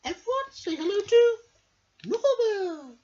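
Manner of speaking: high, bright queen bee voice. Acted. The queen bee greets her pupils at school. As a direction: greet the class like a grand queen. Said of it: "the queen".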